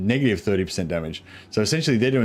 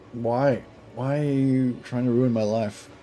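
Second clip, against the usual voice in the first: exasperated at the cat; 2 semitones higher, slower than usual. Real, not acted. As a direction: plead with the pest, drawn out. Complaining to the cat.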